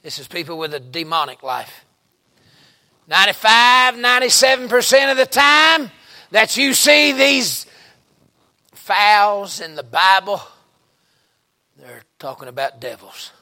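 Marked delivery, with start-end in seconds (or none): 3.1-10.4 preaching at full force. Acted. Preacher raises voice on point.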